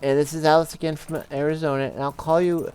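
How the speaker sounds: high-pitched imitation of an old Polish lady; badly faked old Polish lady leaving a voicemail. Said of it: "Polish lady".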